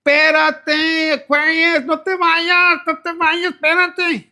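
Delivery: loud, high, drawn-out plea; pleading ex. Acted. Ex begging Aquarius not to go, loud high plea. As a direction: call out desperately after a departing lover. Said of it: "this could be your ex".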